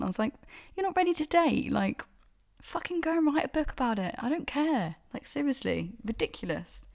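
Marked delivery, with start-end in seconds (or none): 0.8-5.0 high-pitched scornful retort; mock them with exaggerated disbelief; pitched-up, dismissive comeback; scornful mockery. Mocking the date's announcement.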